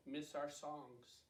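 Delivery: ordinary delivery, nothing marked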